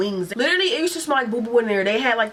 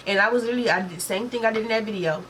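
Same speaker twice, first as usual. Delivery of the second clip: normal talking voice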